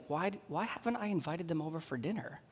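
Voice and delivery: soft imitated inner thought. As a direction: voice a private regret under your breath. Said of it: "they're thinking things like".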